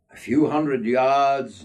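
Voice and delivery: plain reading voice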